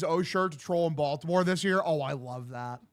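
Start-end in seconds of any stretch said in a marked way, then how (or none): none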